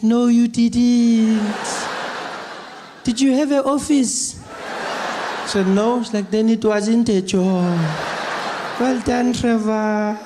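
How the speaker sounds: grandmother voice. Retold or imitated.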